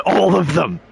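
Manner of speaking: amused disbelief, laughing. Real, not acted. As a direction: burst out laughing as you say it. Repeats with a laugh.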